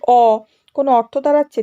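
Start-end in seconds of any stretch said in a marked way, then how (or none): 0.1-0.4 "অ" held long by itself; isolated syllable. Shown as a demonstration. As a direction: draw out "অ" as a lone sound. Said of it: "যেমন যদি আমি বলি". ("অ" একা টেনে বলা)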